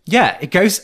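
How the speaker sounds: ordinary delivery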